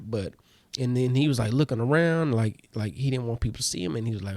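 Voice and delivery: ordinary talk, nothing marked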